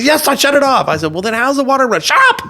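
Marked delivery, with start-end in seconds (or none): 0.0-0.9 loud, high retold protest; defensive. Friend protests, retold quarrel. 1.1-2.0 high-pitched retold comeback; argumentative. 2.0-2.4 very high, loud cut-in; exasperated shout. Retold or imitated. Friend yells, retold quarrel.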